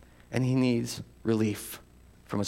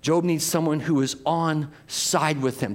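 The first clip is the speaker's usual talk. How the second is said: normal talking voice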